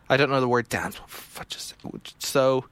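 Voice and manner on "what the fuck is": breathless whispered swear under the breath; whispered aside. Real, not acted. Player baffled by the game's parser, whispering. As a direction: mutter it to yourself unvoiced.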